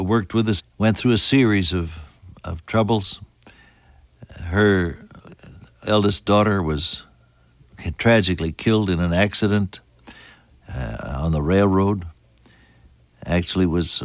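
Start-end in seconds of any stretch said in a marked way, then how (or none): none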